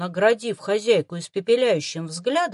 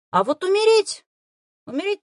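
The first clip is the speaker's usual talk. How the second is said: emphatic; 12 semitones higher, 6 dB louder than usual. Acted. Warning about death.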